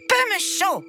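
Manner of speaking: put-on high, whiny child voice listing wishes; a spoiled little prince insisting; demanding child prince. Spoiled prince demanding everything from his parents, high and whiny. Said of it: "répondit le petit prince".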